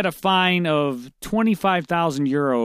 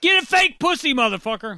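mocking yell; 9 semitones higher than usual. Mock advice to rejected man.

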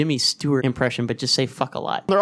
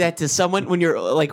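normal talking voice

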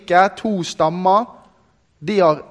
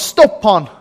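retold shout; 3 semitones higher, 6 dB louder than usual. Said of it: "så roper han".

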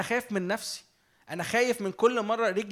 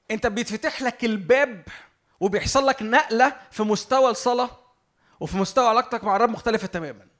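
louder preacher's lift on أنت بيتفتح لك الباب; fervent preaching; 3 semitones higher, 6 dB louder than usual. Acted. Preacher telling the congregation prayer changes, fervent and louder. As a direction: preach it out to the congregation with rising fervor.

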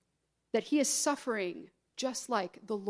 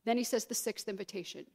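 normal talking voice

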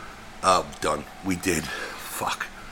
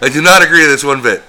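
loud, higher-pitched mock protest; emphatic quoted refusal; 5 semitones higher, 11 dB louder than usual. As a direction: belt out the imitated refusal. Quoting someone's firm on-air refusal.

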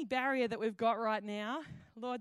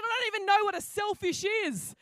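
higher, louder exasperated complaint; exasperated outburst; 8 semitones higher, 6 dB louder than usual. Retold or imitated. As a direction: throw it down in frustration. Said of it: "threw the card on the table and said".

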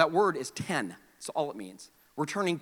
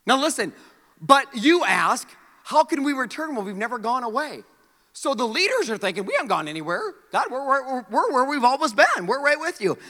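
loud, high, impassioned preaching; pleading sermon shout; preaching at full force; 9 semitones higher, 8 dB louder than usual. Acted. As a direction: cry out God's plea to the people.

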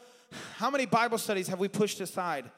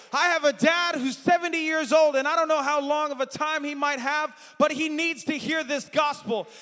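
higher, louder shouted preaching; full-force preaching; 7 semitones higher, 5 dB louder than usual. Acted. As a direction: cry it out over the music.

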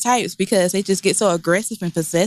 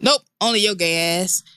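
ordinary talk

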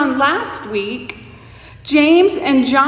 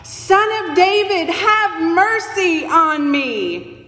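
louder plea; 6 semitones higher, slower than usual. Acted. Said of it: "So he got even more loud".

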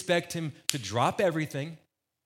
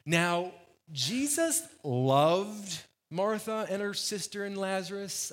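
slow, drawn-out stress on LOVED, pitched up; pointed emphasis; 4 semitones higher, slower than usual. Acted. Preacher voicing John stressing Jesus's love as he writes, pointedly. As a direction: spell it out deliberately, leaning hard on loved. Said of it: "this is how I feel like John wrote this".

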